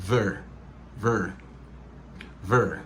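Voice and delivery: short, isolated "ver"; linked sound. Shown as a demonstration. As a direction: run v into er, "ver". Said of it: "her vin pèdi son H".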